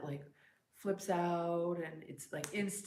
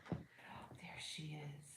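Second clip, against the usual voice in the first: soft slow whisper to the cat; 3 semitones lower, 10 dB quieter, much slower than usual. Real, not acted. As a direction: breathe it softly, slowly. Cat arrives.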